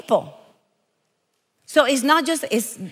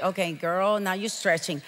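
low, much softer aside; skeptical listener; 6 semitones lower, 9 dB quieter than usual. Retold or imitated. Audience doubting the preacher's principle, a low sceptical aside. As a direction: voice the doubter under your breath. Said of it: "looking at me like".

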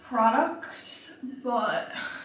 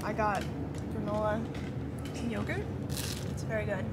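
quiet; 8 dB quieter, faster than usual. Real, not acted. Quiet talk at breakfast table.

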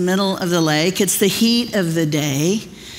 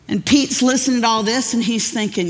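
usual speaking voice

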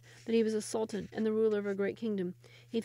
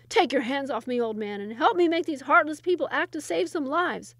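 loud, raised, furious protest; angry demand; 6 semitones higher, 8 dB louder than usual. Acted. Sultan fights off the old man, loud and angry. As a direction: shake him off and demand help.

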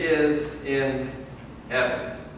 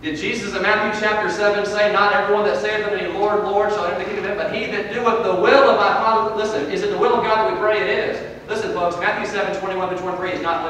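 raised, urgent scripture challenge; forceful preaching; 9 semitones higher, 8 dB louder than usual. Real, not acted. Preacher challenging the church with Jesus's words, forceful preaching. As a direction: press the congregation with the verse.

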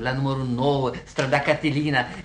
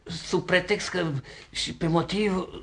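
halting, stammered excuses; embarrassed, faltering backpedal; flustered apology. Acted. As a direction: grope for words with pauses after each fragment. Sees wrong woman, apologises confusedly.

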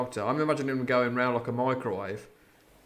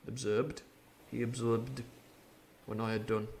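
ordinary delivery

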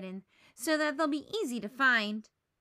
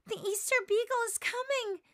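much higher, thrilled announcement; excited; 11 semitones higher, 3 dB louder than usual. Acted. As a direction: announce him with wonder. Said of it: "Linus gasps".

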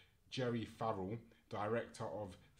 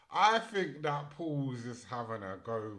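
ordinary delivery

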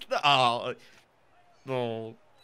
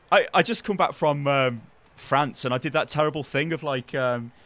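usual speaking voice

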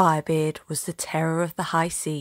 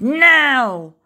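a loud, higher barked "Now!"; shouted command; 6 semitones higher, 8 dB louder than usual. Acted. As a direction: snap the last word out at the crew. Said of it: "Captain Firebeard shouted".

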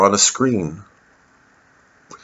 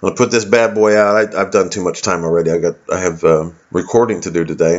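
normal talking voice

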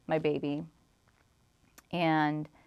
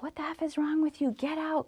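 high, fast mock outrage without volume; retold outrage; 10 semitones higher, 4 dB quieter than usual. Boyfriend orders landlord out of the home, imitated outrage.

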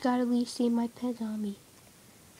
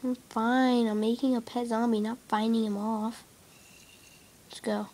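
normal talking voice

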